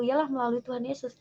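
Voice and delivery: normal talking voice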